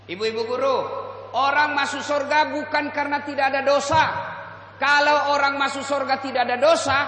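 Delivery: forceful preaching. Acted. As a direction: preach it out to the hall.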